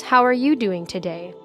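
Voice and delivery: ordinary talk, nothing marked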